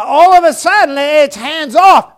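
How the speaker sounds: rising pulpit indignation; indignant preaching burst. Real, not acted. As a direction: hit it like an outrage from the pulpit. Preacher builds to indignant climax.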